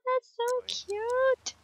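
high, slow squealing coo; drawn-out high coo. Real, not acted. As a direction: squeal it out like seeing a puppy. Gushing over cute sims.

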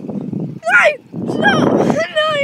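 high, laughing begging; real giggling panic; laughing plea. Real, not acted. Begs to stop, laughing.

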